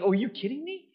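high-pitched incredulous question; mock disbelief. Onlookers freaking out over forgiven sins, mock disbelief. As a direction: jump up in pitch, acting scandalized.

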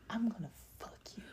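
fast breathy whisper right into the ear; retold whisper. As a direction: hiss it quickly like a secret in someone's ear. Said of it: "he comes to my ear and he goes".

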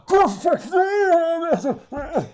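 loud, high, garbled roar; Bane. Retold or imitated. Agent on a windy, bad phone line, garbled villain bellow. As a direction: imitate a distorted shout down a bad line. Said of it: "like Bane".

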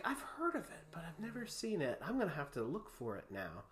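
softly voiced quote of a mother; imitating mother. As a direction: drop to a hushed, near-whispered retelling. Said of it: "She's like".

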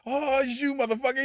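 high, squeaky mock voice, laughing through it; mock movie character. Retelling a funny movie scene to friends, squeaky mock voice. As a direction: pitch way up, imitating the brother. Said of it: "'cause he was like".

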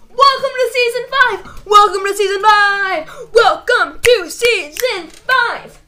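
high, loud hype yell; excited shout. Acted. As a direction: belt it like an announcer.

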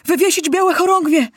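high, loud panicked cry; frightened shout. Acted. Councillor panics after the bomb, screaming in fear. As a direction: yell it in terror as the windows shatter. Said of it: "krzyknął ktoś przestraszony".

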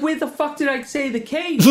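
high, loud, incredulous complaint; exasperated mock outrage. Retelling the spelling mix-up, a high exasperated outburst. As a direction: throw up your hands in disbelief. Retelling frustrating phone call.

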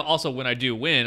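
usual speaking voice

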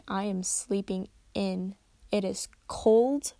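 ordinary talk

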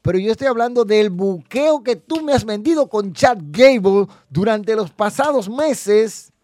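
loud, high, mounting indignation; angry rant. Real, not acted. Fan accusing the booker of wasting Chad Gable, loud indignant rant.

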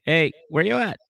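high-pitched phone greeting; light, high impression of a caller; imitated girlfriend. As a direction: lift it high, mimicking a girlfriend calling. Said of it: "and she's like".

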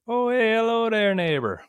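high, loud Canadian greeting; hammed-up Canadian holler, dropping on the last word; Canadian accent. Shown as a demonstration. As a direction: put on a cartoonish Canadian accent, calling out high. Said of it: "Canadian accent".

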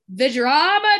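campy theatrical gasp; dramatic. Pretend. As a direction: ham up the drama. Campy exclamation about the drama.

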